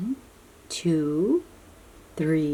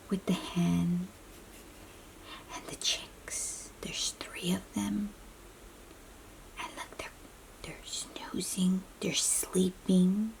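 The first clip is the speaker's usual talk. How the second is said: softer and softer bedtime whisper; fading sleepy whisper; 7 dB quieter than usual. Acted. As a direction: whisper it down so nobody wakes. Animals snoozing, whispered reading.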